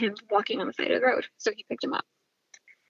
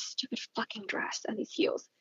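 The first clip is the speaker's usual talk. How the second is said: whispering; 11 dB quieter than usual. Real, not acted. Aside about her dress.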